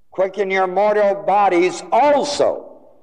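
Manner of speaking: high, forceful reading landing hard on "also"; stress on also. Shown as a demonstration. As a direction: punch "also" at the end, high then falling. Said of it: "That is to be emphasized".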